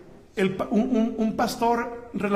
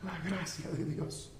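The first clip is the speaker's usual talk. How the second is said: very soft, dropping lower; solemn hush; 3 semitones lower, 12 dB quieter than usual. Real, not acted. Preacher tells congregation of the martyrs and grace, hushed. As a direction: speak in awe, barely voiced.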